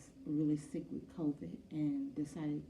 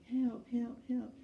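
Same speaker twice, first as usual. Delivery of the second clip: high, loud calls for help; retold panicked scream; 7 semitones higher, 4 dB louder than usual. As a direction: cry out in fright, high. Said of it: "began to scream to the top of her lungs".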